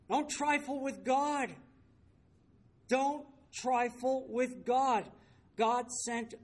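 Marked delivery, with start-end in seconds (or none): 0.1-1.5 high, emphatic preacher's warning in one run; raised-pitch pulpit admonition; stern warning. Real, not acted. Preacher warns, then repeats it slowly. 2.9-5.1 high, word-by-word warning with pauses; drawn-out pulpit admonition; slow emphatic warning. Real, not acted.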